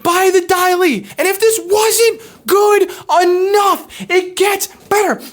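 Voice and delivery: excited rave. Real, not acted. Fan raving over brainwashing scene.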